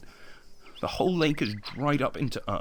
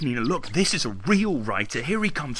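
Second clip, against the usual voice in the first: ordinary talk, nothing marked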